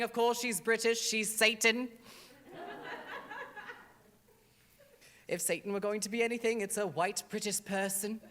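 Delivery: put-on British accent as Satan, loud and grand; a haughty British Satan in a hammed-up British accent; a drag queen's campy British devil voice; fake British accent. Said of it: "that was a fake accent".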